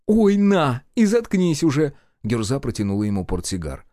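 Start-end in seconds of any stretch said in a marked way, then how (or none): 0.0-1.9 high, loud exasperated retort; fed-up woman's voice; irritated. A narrator's reading. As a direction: shove it at him and shut him up.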